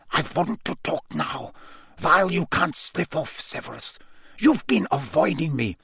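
restrained voice through barely open lips; tight-lipped Karkaroff. A narrator's reading. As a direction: press him like a poor ventriloquist. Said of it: "barely opening his lips".

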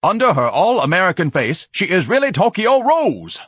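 high, loud accusing shout; outraged cry. A narrator's reading. Ignatius denounces the heroine as the film ends, crying out. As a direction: cry it out, stretching the final name. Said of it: "Ignatius cried".

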